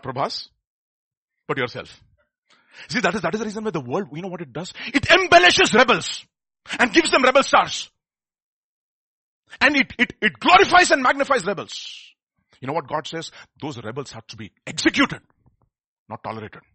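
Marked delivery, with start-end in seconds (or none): 4.9-12.1 loud, slow fiery preaching; preaching at full force. Acted. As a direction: thunder it from the pulpit.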